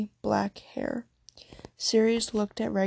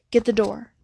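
firm, louder order to the servant; a clipped, cold command; stern command; 5 dB louder than usual. A narrator's reading. Mistress ordering her house elf to answer, sternly. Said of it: "Wallaburger's voice was stern".

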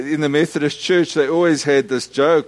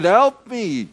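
high, raised help me; retold shout; 8 semitones higher, 3 dB louder than usual. Man still calling for help, raised cry. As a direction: call it out again. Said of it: "continued to shout like that".

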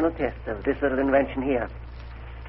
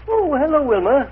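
jumpy, much higher hello; caught-out overbright welcome; startled greeting; 11 semitones higher, 4 dB louder than usual. Acted. Caught off guard by visitor.

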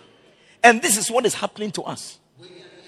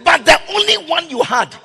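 loud, high, rising preacher's cry; fiery sermon climax; preaching at full force; 9 semitones higher, 10 dB louder than usual. Real, not acted. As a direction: preach it out, building to a shout.

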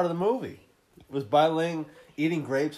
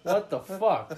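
usual speaking voice

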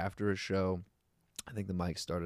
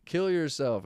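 retold yell; 7 semitones higher, slower than usual. Retelling what somebody yelled at a comic, mock yell. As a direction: imitate a heckler's yell lightly. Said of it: "somebody did yell".

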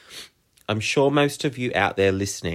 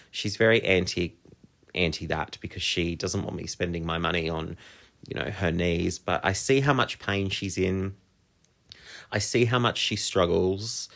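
ordinary talk

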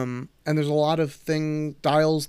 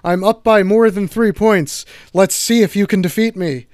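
loud, higher villain taunt; gloating evil villain; 5 semitones higher, 10 dB louder than usual. Pretend. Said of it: "evil villain syndrome".

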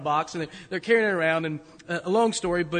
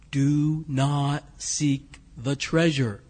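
low, slow, drawn-out warning from the dark; eerie voice from behind; 5 semitones lower, much slower than usual. Retold or imitated. Pete, unseen behind the friends in the theater, giving a slow low eerie warning. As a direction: intone it like a ghostly warning. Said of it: "they hear a voice coming from behind them".

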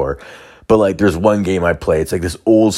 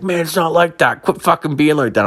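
much higher, whining protest; whiny defensive buddy; 11 semitones higher than usual. Retold or imitated. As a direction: protest like a hurt teen gamer. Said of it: "And he's just like".

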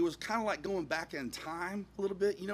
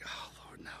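hushed, retold plea; whispered dread; 15 dB quieter than usual. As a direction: breath-only groan of dismay. Car will not start.

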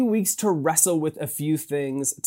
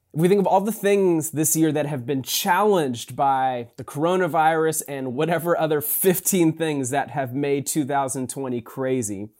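ordinary talk

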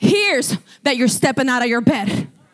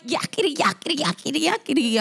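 lightly voiced creature chatter; ugly squeaky creature; 4 dB quieter than usual. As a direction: imitate a nagging creature's jabber. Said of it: "making this ugly, squeaky".

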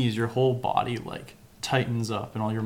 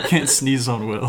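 laughing while talking; 8 dB louder than usual. Real, not acted. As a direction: say it through a real laugh. Laughing refusal to demo sneeze.

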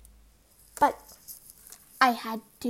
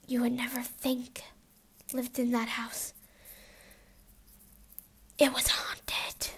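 spooky whisper; 3 dB quieter than usual. Acted.

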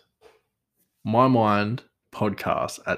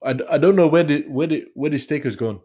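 put-on higher, louder, botched Indian accent; shaky-footed stab at an Indian accent; attempted Indian accent; 6 semitones higher, 6 dB louder than usual. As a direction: try an Indian chef and miss. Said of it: "Is that, is that Indian?".

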